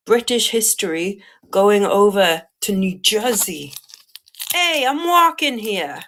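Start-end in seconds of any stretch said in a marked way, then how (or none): 4.5-6.0 put-on high, drawn-out New York accent. Seller shows off to New Jersey buyer, mock New York accent. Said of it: "that's my New York accent".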